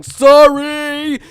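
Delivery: high, loud drawn-out apology; mock frantic shout. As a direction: holler it while barging through. Shouting while charging through crowd.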